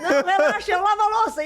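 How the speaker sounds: high laughing reassurance; husband calming everyone, laughing. Retold or imitated. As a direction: laugh while promising to do dishes.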